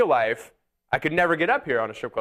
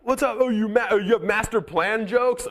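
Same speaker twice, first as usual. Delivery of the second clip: high-pitched imitation of an offended heckler; mock confrontational; 8 semitones higher than usual. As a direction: challenge the comic on his jokes. Acts out offended audience confronting him.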